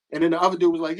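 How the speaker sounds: ordinary talk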